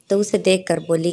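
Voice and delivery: ordinary delivery, nothing marked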